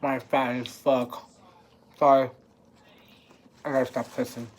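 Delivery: talking with a mouth full of burger. Real, not acted. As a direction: chew and talk through the food. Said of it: "I'm talking with my mouth full".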